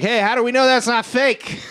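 high-pitched obnoxious auction heckle; heckling shithead. Retold or imitated. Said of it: "he starts being a shithead".

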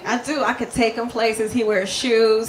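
loud, punchy stand-up set; stand-up delivery. Acted. On stage joking to the crowd about her husband, loud and punchy. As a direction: work the crowd with big energy.

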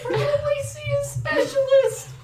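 very high squeaky report; tiny mouse boy. Acted. Mouse boy inside his body.

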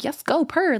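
purring fake fan; sarcastic hype. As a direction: coo praise you don't mean. Mock-praising haters online.

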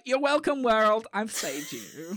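slowed, grandly smug sign-off; mock smug. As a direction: parody her smug good deed. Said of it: "It's like".

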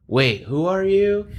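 high, slow, stretched-out confusion; confused questioning. Retold or imitated. Dazed patient questions the medic, confused. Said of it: "Just, like, repetitive questioning".

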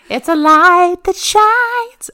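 slow and high, hammed-up pride; mock grandiose. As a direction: puff up like a star, slowly. Mock grand self-praise.

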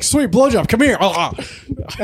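hammed-up eager call; mock eager. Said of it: "I'm not usually like".

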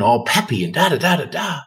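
high, bouncy sing-song patter; peppy. Shown as a demonstration. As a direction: act out an over-peppy entrance. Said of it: "I used to be peppy".

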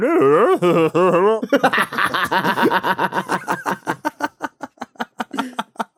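long high laughing fit. Real, not acted. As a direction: laugh hard for a while. Long laugh at friend's story.

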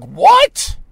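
high, loud, rising disbelief; put-on astonishment; feigned shock. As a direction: fake being shocked, voice jumping up. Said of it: "I'm making believe I'm shocked".

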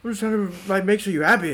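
high, earnest dad voice; imitating dad. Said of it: "He's just thinking, like".

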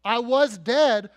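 loud, slow, punched words; forceful, deliberate testimony as the risen Christ; triumphant declaration. Acted. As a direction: proclaim it at full preaching force. Preacher voices Christ declaring victory.

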